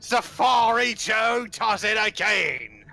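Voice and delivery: slow, loud big game hunter boast; boastful colonial bluster. Retold or imitated. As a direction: stretch it out like a proud British hunter. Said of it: "one episode of him going".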